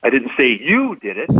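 "you" lifted and weighted, the line falling after it; single out "you" as not the one; stress on YOU. Shown as a demonstration. Teaching statement analysis, stress on "you". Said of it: "I said somebody else did it".

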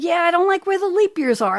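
high-pitched mock whine; imitated pope. Voices the pope answering his mother.

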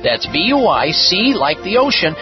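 radio ad read over music; infomercial. Acted. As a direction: pitch the product to listeners. Host reads his own ad.

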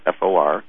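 F O R spelled letter by letter; spelling it out. Shown as a demonstration. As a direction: spell out F O R one letter at a time. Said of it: "It's all one word".

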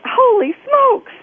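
high, loud, drawn-out mock amazement; amazed. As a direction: act out being astounded, stretching the words. Retelling her amazed reply.

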